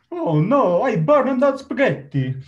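high, hammed-up Italian lament; Italian accent. Shown as a demonstration. Comic as a cook who burned the spaghetti, dismayed Italian accent. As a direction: wail in a cartoonish Italian accent. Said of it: "how about Italian?".

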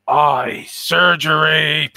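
slow, mock-dramatic reveal; ominous announcement, hammed up for laughs. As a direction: draw it out like a B-movie horror title. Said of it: "I don't actually have the ability to make lightning sounds".

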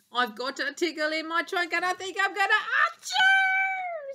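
rising, hurried build to a shrieked sneeze; elephant about to sneeze. Acted. Elephant has a tickly trunk, building sneeze.

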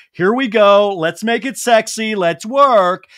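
loud, punchy cheerleading on the shoot; hyped-up photographer. Retold or imitated. Photographer urging on the shoot, hyped-up impression. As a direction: pump up the model with loud bursts. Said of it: "a photographer's like".

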